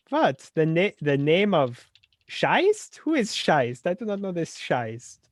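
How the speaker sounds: a lightly voiced Scottish accent, rising on the odd word; a hint of a Scottish ghost, badly done. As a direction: mangle a Scottish accent for laughs. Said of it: "Sorry, I shouldn't do your accent".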